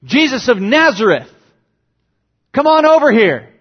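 casual hail that swells into a high, loud call. Pretend. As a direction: call him over across the crowd. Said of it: "Does he call out".

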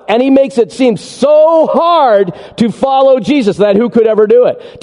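ordinary talk

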